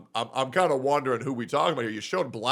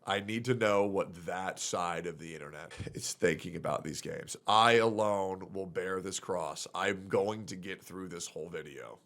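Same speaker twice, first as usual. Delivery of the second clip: quiet resigned; 5 semitones lower, 6 dB quieter than usual. Real, not acted. Resigned mock martyr to chat.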